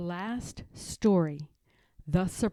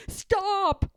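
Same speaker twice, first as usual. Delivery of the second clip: much higher, loud cry to stop; frightened cry; 14 semitones higher, 8 dB louder than usual. Acted. George begs Martha to stop the scary story, loud cry. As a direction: yelp it out in fright. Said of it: "cried George".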